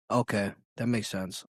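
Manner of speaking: low, quiet sarcastic agreement; understated sarcasm, dropping away. Real, not acted. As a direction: concede it softly, not meaning it. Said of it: "I'm just being sarcastic".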